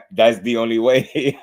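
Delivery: put-on Mexican-accented catchphrase through laughter; Canelo accent impression. Streamer repeating a boxer's line for laughs, giggly Mexican accent. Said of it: "I'm just making light of his accent".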